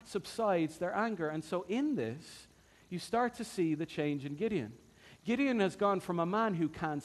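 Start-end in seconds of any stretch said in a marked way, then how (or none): none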